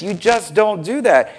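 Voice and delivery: normal talking voice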